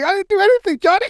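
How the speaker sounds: high, loud, whining mock plea; hammed-up terrified victim whine; frightened guy pleading with Johnny, high mock whine; whiny denial.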